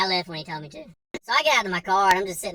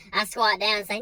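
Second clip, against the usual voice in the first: usual speaking voice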